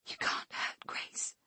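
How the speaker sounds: a slow, breathy whisper; mother whispers the truth to her captor. Acted. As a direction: whisper it as a secret weapon. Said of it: "I whisper".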